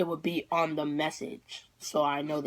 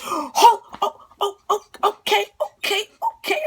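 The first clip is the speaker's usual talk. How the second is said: very high, breathless squeaky stammer; hammed-up near-crying joy, pitch shooting up; retold overwhelmed excitement; 14 semitones higher, 8 dB louder than usual. Daughter to mom who says they can go, overwhelmed squeals. Retold overjoyed reaction to going.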